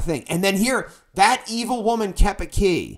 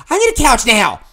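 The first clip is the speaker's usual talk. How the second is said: pushy, raised voice; firm demand; 6 semitones higher, 8 dB louder than usual. Retold or imitated. Retelling his demand to the clerk, raised and firm. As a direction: lean on it, you want the couch today.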